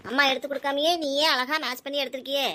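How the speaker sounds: normal talking voice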